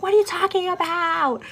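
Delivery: high, indignant comeback; hammed-up protest from a quarrel; retold bickering. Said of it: "getting real ugly".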